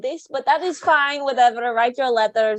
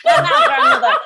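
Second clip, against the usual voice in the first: laughing; 9 dB louder than usual. Acted. Vampire dismissing old lady, laughing.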